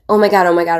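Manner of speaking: loud breathless oh my God; excited fangirl squeal. Retold or imitated. Teenage girls spotting a TV star, squealing loudly. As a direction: gush like starstruck teens. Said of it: "They were freaking out".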